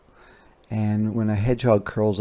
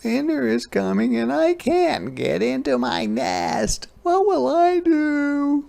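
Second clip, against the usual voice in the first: wailing. A narrator's reading. Hedgehog can't get into her nest, high sad wail. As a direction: whimper out the worry. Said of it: "wailed Hedgehog".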